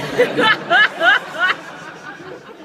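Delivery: high character laugh. Acted.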